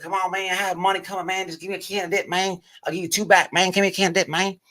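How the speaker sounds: put-on high, pleading country-accent voice; big country accent. Broke inmate begging everybody for dip, big country accent. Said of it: "he had this big old country accent".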